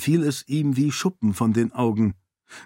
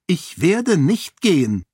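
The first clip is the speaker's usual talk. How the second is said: higher, slow, defiant woman's voice; defiant refusal; 7 semitones higher, 4 dB louder than usual. A narrator's reading. Sarene refusing Raoden's push toward the gate, slow defiant voice. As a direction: plant your feet and refuse.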